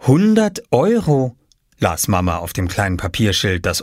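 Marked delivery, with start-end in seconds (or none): 0.1-1.4 high, slowly read out in amazement; astonished. A narrator's reading. Mama reads her son's sign, high and astonished. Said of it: "und staunte".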